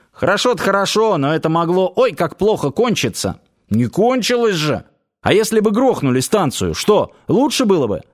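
voiced guard in a lively dispute; heated arguing. A narrator's reading. Guards argue heatedly in story.

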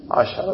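عشرة in three syllables, "a-sha-ra"; fatha on the shin. Shown as a demonstration. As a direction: say عشرة as "ashara", short a after the shin. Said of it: "بالفتح".

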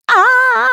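loud drawn-out yell of pain; long high scream. A narrator's reading. Spy with nose bitten by parrot, screaming. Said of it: "завопил шпион Дырка".

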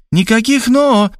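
high, sharp cut-off; cutting Tamara. A narrator's reading. Tamara sharply cutting off Robert's excuses. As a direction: shut down the objection. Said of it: "отрезала Тамара".

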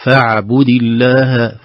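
slow فاعبد, ain kept in the middle; right middle ain. Shown as a demonstration. Teacher showing the right way, a middle ain. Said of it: "نقول".

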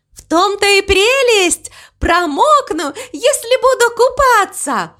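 high, gleeful cow voice; gleeful excitement. Acted. Mama Mu answers crow's warning, high and gleeful. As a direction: exclaim it high with delight. Cow thrilled about getting wet.

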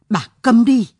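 loud, cutting command to shut up; furious husband silencing her; angry shout. Acted. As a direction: bark it at the wife. Husband silences crying wife.

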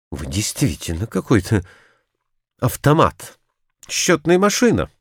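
mild indignant cry, climbing in pitch; exclaiming. A narrator's reading. Narrator reproaches his unfeeling friend, crying out mildly. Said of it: "крикнул я".

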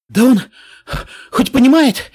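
loud, high exasperated grumble; grumbling outburst. Acted. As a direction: snap it out, fed up. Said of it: "проворчал Грифон". (раздражённое ворчание вслух)

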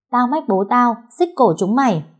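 high-voiced little girl's threat; cocky threat. A narrator's reading. Little girl to the bullying boys after a fight, cocky threat. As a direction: threaten them with your dad, chin up. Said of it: "Cô vênh mặt lên dọa".

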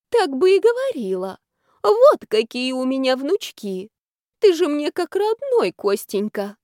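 high, loud doting praise; gushing old woman. Acted. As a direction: lay the flattery on thick. Granny gushing over grandson-in-law.

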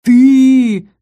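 loud, high, long wail; booming ghost cry. A narrator's reading. Ghost calling after fleeing pair, loud drawn-out howl. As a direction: let it echo after the runaways. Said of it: "гулом неслось следом за нами".

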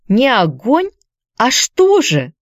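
put-on high, puzzled girl's question; surprised question. A narrator's reading. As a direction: ask in surprise. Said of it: "спросила Хайди". (удивлённый вопрос девочки)

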